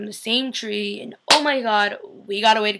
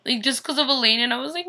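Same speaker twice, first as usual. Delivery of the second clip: ordinary talk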